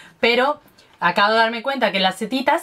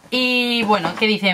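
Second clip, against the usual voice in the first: ordinary delivery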